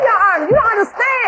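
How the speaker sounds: high yelled protest; shouting match. Real, not acted. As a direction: yell it over the judge. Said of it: "[name], stop yelling at me".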